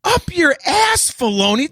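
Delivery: high, slow, yelled jab; mock shout. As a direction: fling it up high at Filoni. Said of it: "why didn't somebody go".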